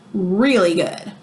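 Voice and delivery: ordinary delivery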